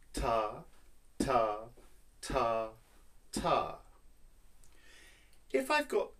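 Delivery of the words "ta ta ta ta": even ta on each beat; rhythm syllables. Shown as a demonstration. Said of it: "say this rhythm".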